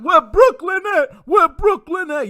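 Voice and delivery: high, slow shouted hype call in a borrowed rapper voice; loud mock rapper shout-out. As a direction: belt it out like a rapper hyping the crowd. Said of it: "I can't do the [name] voice".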